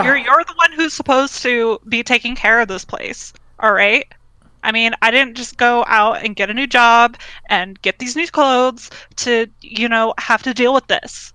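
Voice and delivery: exasperated, entitled complaint; indignant scolding. Acted. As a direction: berate Dad for neglecting the house. Scolding father about the house.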